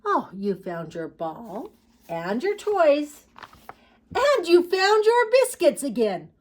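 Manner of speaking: put-on storybook child, building to high and loud; little girl. Little girl to her puppy, voice rising in mock excitement.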